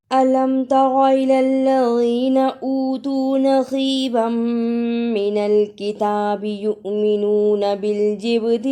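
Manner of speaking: slow melodic tajweed chant; Quran recitation. Acted. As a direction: recite each phrase long and sung. (آہستہ ترنم سے تلاوت)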